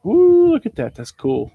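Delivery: a sudden high squeal of delight on ooh. Real, not acted. Seller showing viewers a brass find, delighted high ooh. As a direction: gush over the find like it just surprised you. Spotting a cool item while unpacking.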